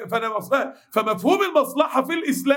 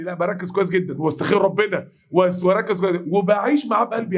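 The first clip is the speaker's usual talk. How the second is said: ordinary talk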